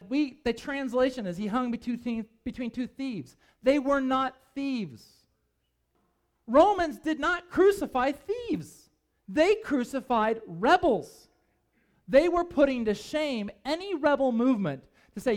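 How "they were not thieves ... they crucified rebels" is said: slow, higher and louder, each word hammered; slow emphatic correction. Real, not acted. Preacher to congregation, insisting slowly and loudly. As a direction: drive home that they were rebels, not thieves.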